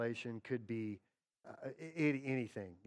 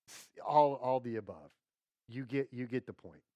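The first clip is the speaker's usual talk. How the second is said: normal talking voice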